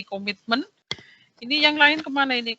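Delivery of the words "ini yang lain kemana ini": raised voice calling for students. Real, not acted. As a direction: call out to the missing class. Teacher calling absent students online.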